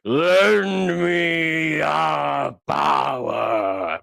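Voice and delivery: slow, drawn-out evil sorcerer chant; hammed-up evil wizard invocation; villain incantation. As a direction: intone it like a cartoon villain summoning spirits. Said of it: "that's what he said".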